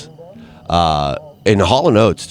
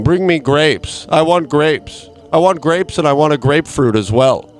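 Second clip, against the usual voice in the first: mock king; 6 semitones higher than usual. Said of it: "sitting on my throne and saying".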